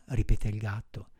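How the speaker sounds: ordinary delivery, nothing marked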